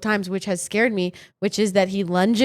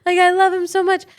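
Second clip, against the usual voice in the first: upset plea; 8 semitones higher, 4 dB louder than usual. Retold or imitated. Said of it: "And I'm crying".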